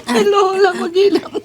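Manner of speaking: being tickled. Real, not acted. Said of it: "Nakikiliti ako sa silong eh".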